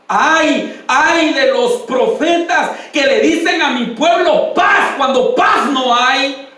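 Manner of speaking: slow booming sermon shout; preaching at full force. Real, not acted. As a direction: thunder the woe over the congregation. Prophetic woe cry in sermon.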